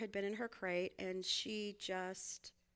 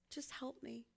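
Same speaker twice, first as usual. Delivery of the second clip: whispered plea; 10 dB quieter than usual. Retold or imitated. Overwhelmed, asking the boys.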